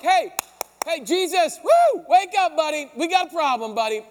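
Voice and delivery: loud, high panicked calls to wake him; frantic calling. Retold or imitated. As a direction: shout like scared men shaking a sleeper awake. Disciples frantically waking Jesus.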